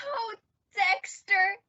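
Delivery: ordinary delivery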